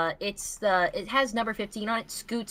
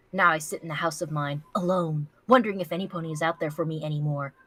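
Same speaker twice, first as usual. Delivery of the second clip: usual speaking voice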